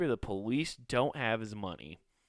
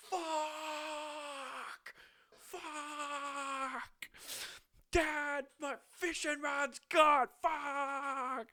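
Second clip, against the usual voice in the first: much higher, strained wail of loss; hammed-up howl over a lost fishing rod; mock anguished rage; 5 dB quieter, much slower than usual. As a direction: prisoner howling in his cell over his rod, mock anguish. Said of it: "so angry his fishing rod's gone".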